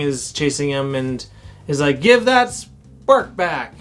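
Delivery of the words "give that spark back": mock demand, drawn out and higher; slow, hammed-up chase yell; villain's demand. Villain acted while chasing.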